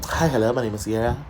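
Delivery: mock refined Sierra, hushed and precious. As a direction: play the polished rich wife, gently. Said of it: "Sierra talk like this now".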